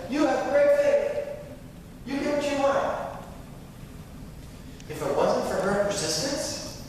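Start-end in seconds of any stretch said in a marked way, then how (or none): 0.1-3.2 Jesus praising her. Retold or imitated. Jesus praising the Canaanite woman's faith, exultant. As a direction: proclaim it like a blessing.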